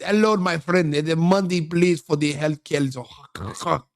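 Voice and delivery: hammed-up mock Somali accent, slowing at the end; fake Somali accent. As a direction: put on a badly faked Somali accent. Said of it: "put on a fake accent".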